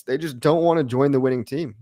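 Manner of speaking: ordinary delivery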